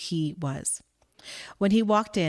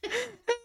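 short laugh; 4 dB quieter than usual. Acted. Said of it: "slightly embarrassed that she was caught".

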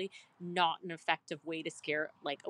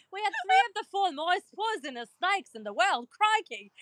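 high-pitched, hammed-up Australian snake hunter; mock Australian accent; 12 semitones higher, 4 dB louder than usual. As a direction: exaggerate a silly Australian accent. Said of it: "Are you Australian?".

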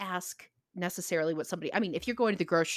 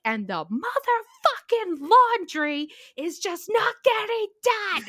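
high anguished wail; 13 semitones higher, 6 dB louder, slower than usual. Retold or imitated. Telling her friend on the phone, a high slow wailed outburst. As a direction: wail it high and drawn out, near breaking. Retelling crying rant to friend.

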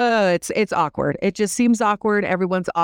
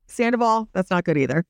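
ordinary talk

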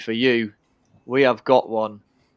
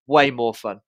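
ordinary talk, nothing marked

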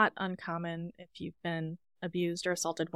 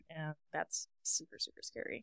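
hushed whisper; 2 semitones lower, 8 dB quieter than usual. Real, not acted. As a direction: whisper it like the fear is close. Hushed over a scary scene.

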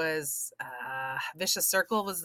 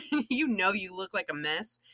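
usual speaking voice

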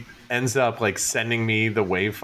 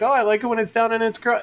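quick, high-pitched mimicked admirer; gushing compliment; 11 semitones higher, faster than usual. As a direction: imitate an excited fan of the hair. Imitating people complimenting his hair.

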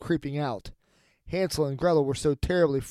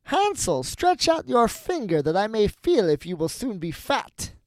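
high, swooping witch call; witch crying out; 7 semitones higher than usual. A narrator's reading. Witch calls into Hansel's stable, high demanding cry. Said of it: "crept to the little stable and cried".

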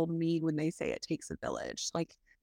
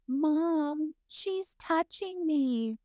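whiny tattling little sibling; 10 semitones higher, much slower than usual. Retold or imitated. Child tattling to mom.

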